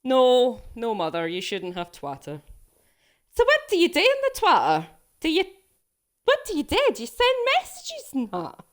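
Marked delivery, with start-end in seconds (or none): 3.3-8.6 high, fast, puzzled mock-mum voice; mum impression. Streamer voices her mum quizzing her about Twitter, high and fast. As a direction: rattle off questions as the baffled mum, pitched high. Daughter voicing her mum's questions.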